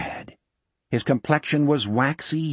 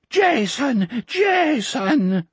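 high, tearful sobbed name; sobbing; 11 semitones higher, much slower than usual. A narrator's reading. Old man at Jack's feet, sobbing with joy. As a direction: sob the name out. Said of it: "the old man sobbed".

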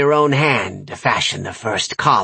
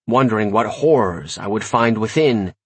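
plain reading voice

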